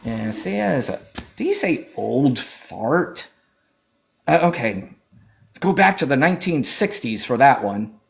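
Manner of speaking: ordinary talk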